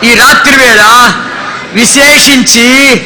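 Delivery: slow fervent prayer line by line; slow call-and-response prayer. Shown as a demonstration. Preacher leading the congregation to repeat, slow fervent prayer. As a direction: lead the crowd in prayer, slowly. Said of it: "బిగ్గరగా చెప్పాలి".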